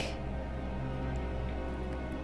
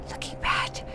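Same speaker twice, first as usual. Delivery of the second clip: whispered; faster than usual. Acted. Same words said again, whispered.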